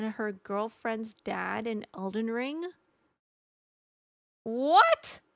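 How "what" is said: high, loud, rising disbelief; incredulous. Real, not acted. Streamer reacting to chat's meteor story, incredulous. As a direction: shoot up loudly in stunned question.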